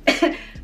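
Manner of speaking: put-on choking cough; acted coughing fit. Smoker choking on a pull, imitated cough. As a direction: imitate coughing on a tickle in the throat. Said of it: "So now I'm coughing".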